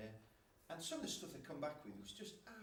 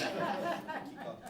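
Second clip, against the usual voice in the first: high, loud laughing thanks; laughing delight; 9 dB louder, much slower than usual. Retold or imitated. Preacher retelling his joy at the lesson topic, high and laughing.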